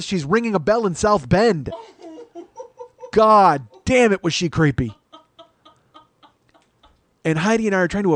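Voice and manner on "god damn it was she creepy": loud, high, drawn-out exclamation; exasperated outburst. Real, not acted. Comic recalling the creepy waitress, exasperated. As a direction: stretch the curse out slowly, loudly.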